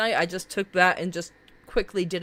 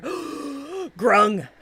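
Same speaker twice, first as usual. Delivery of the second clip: gasping delight; 6 semitones higher than usual. Real, not acted. Excited at frog character.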